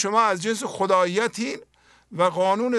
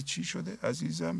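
put-on much softer, gently asking a child what is wrong; soft and tender; 2 semitones lower, 11 dB quieter than usual. Parent softly comforting a screaming child, tender hush. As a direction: hush your voice and soothe the child. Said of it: "میری یواش میگی".

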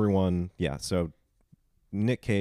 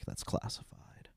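slow, hushed secret whisper; 9 dB quieter than usual. Retold or imitated. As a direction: say it slowly under your breath as a secret. Secretive answer, retold again.

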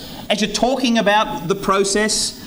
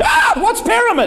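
much higher startled question with a laugh; alarmed prospect; 12 semitones higher than usual. Pretend. Prospect catching the word pyramid, startled and much higher. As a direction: jump up in pitch, suddenly suspicious.

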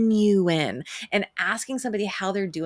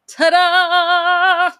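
1 excited ta-da; 11 semitones higher, 12 dB louder than usual. Pretend. Presenting a surprise greeting.

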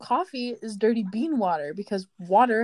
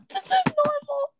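high-pitched giggling; squeaky laugh. Real, not acted. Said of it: "Would you laugh normally?".

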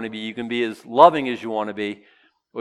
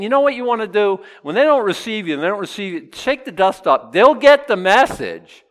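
higher and louder, pressing the point; higher, louder, emphatic advice; 7 semitones higher, 6 dB louder than usual. Retold or imitated. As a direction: tell them with force. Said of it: "So he's saying".

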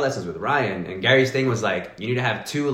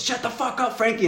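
high-pitched retold yell, not full volume; imitated yell. Tripping roommate yells at Frankie out of nowhere, mock yelling. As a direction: act out a sudden high-pitched outburst. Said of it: "starts yelling at him hella loud".

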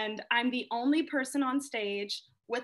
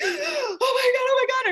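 high, frantic panicked gasps of oh my God; breathless, near-tearful terror, pitched way up; retold freak-out; 13 semitones higher, 9 dB louder than usual. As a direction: relive the panic of thinking you were shot. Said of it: "I'm freaking out".

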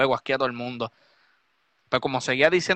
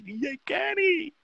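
mock sweet greeting; 13 semitones higher, much slower than usual. Fake-friendly greeting by hypocrites.

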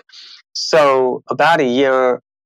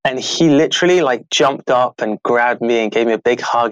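ordinary talk, nothing marked

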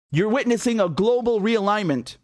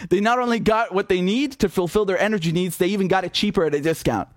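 ordinary delivery

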